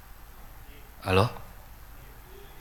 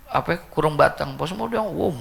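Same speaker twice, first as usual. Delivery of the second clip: ordinary talk, nothing marked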